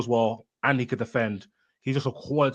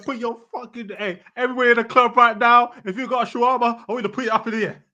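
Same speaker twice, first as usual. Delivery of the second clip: high, loud club MC shout; mock party announcer, pitched way up; club hype man; 11 semitones higher, 6 dB louder, faster than usual. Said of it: "Man said".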